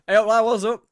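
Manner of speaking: hammed-up, loud, high greeting to bar staff; playful over-the-top shout across the bar. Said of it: "speak how you talk to the person behind the bar".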